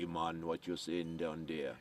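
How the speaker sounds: lightly voiced Rastafarian call. Introducing his Rastafarian character Iman, a light Jamaican accent. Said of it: "the Rastafarian".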